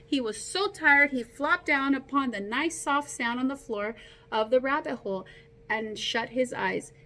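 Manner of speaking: usual speaking voice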